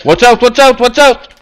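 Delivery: high, loud warning cry; alarmed warning shout. Real, not acted. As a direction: yell the warning to save them. Warning of enemy in corner.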